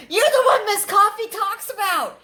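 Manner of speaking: excited fan. Retold or imitated. Cashier recognizing him from the channel, high excited imitation. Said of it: "they both start, like, jumping up and down".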